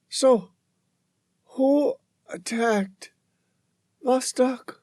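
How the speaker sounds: high, faint, pleading woman's voice; weak pleading woman. Acted. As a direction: plead weakly, near the end. Dying woman asks.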